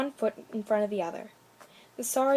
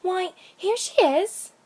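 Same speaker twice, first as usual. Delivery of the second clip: put-on high, slow bright girl voice; cheerful little girl; 9 semitones higher, 7 dB louder, slower than usual. A narrator's reading. Little girl to the woodcutter, high and slow. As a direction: proudly show off grandmamma. Child's lines in story.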